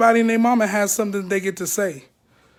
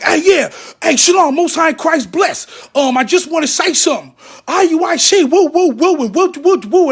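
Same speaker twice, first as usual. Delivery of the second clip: higher and louder, a mock rowdy sign-off; hammed-up farewell shout; 6 semitones higher, 5 dB louder than usual. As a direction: blow the roof off your goodbye. Imagines a dramatic exit speech.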